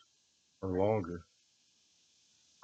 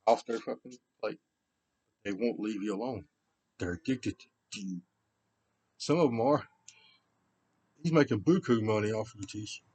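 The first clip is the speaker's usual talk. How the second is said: ordinary talk, nothing marked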